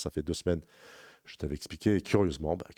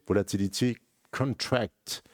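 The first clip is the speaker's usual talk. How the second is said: English terms in a deliberate English accent, drawn out; slow down and put on your English accent; 6 semitones higher, slower than usual. Shown as a demonstration. Trader naming the volatility pattern for viewers, English accent. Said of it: "je prends mon accent anglais".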